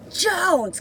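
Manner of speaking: high, loud summons by surname; mock yell. Guard calling out an inmate by last name, mock yell. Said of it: "yelling at me".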